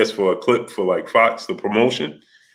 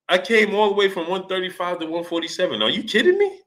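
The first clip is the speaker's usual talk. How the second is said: high, incredulous mock Manny voice climbing to a squeak; imitating Manny; 7 semitones higher, 4 dB quieter than usual. Host imitates Manny bragging about moving up weight, high incredulous. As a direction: sell the disbelief, push the last line way up.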